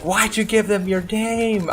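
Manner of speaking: high, drawn-out mock girlfriend voice; exasperated girlfriend. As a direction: wail the question like an appalled girlfriend. Voices girlfriend scolding him.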